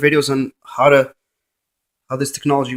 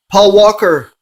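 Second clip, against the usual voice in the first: louder call of the name; louder call to the spirit; 4 semitones higher, 5 dB louder, slower than usual. Real, not acted. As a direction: summon him by name, voice raised. Calling the ghost by name.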